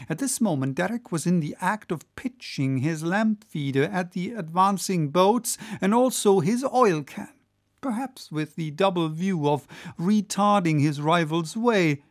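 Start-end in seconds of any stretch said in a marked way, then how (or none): none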